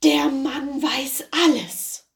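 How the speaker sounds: a terrified servant leaping out of the oven; terrified cry. Acted. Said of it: "sprang voller Schrecken heraus und rief". (der Diener springt voller Schrecken heraus, langsam und gehaucht)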